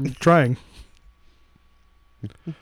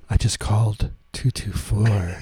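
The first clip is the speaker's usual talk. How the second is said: sexy phone voice; 7 semitones lower than usual. Pretend. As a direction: drag out the number seductively. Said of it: "do your sexy phone voice".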